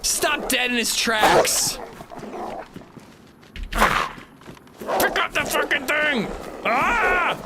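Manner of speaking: high, slow, panicked pleading; panicking gamer. Real, not acted. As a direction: beg the game in a panic. Fighting wolves, panicking.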